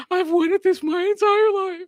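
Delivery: very high, squealing mock excitement; gasp, then squeak it out like a superfan; hammed-up ecstatic squeal; gushing tryhard. Mimics over-eager Starfleet tryhards.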